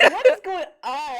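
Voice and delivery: high, loud bewildered question; baffled men. Retold or imitated. The two men staring at the possessed wife, loudly bewildered. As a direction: burst out in confused disbelief.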